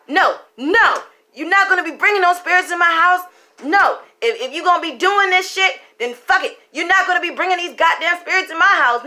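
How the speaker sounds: high, loud angry rant; fiance ranting. Retold or imitated. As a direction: lay down the law, fed up. Fiance lays down the law.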